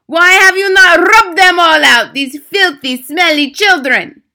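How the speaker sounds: high, very loud outraged shriek; screaming Grand High Witch. A narrator's reading. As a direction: scream it in a foreign witch's accent. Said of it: "she screamed".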